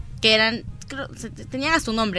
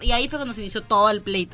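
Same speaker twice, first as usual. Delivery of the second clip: ordinary talk, nothing marked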